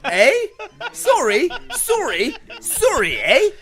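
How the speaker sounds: mock Canadian with sing-song eh and sorry; Canadian accent. Host reads the question in a hammed-up Canadian accent for friends. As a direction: overplay the polite Canadian. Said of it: "in Canadian".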